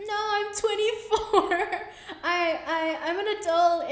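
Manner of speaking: high, loud, laughing disbelief; laughing protest. Retold or imitated. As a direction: laugh it off, insisting you're an adult. Laughing reply to the agent's question.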